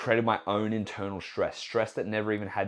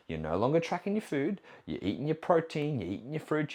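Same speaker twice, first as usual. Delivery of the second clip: ordinary talk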